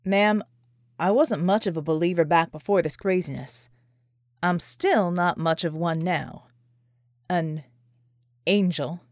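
skeptical. A narrator's reading. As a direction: let the disbelief hang on angel. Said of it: "John arched an eyebrow skeptically".

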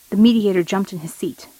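ordinary delivery, nothing marked

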